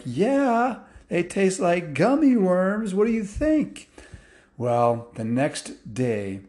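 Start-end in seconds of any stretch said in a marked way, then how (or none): 0.0-3.9 high and eager, a little louder; excited kids. Acted. Kids tell parents the fish taste like gummy worms, excited. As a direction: gush it out like thrilled children.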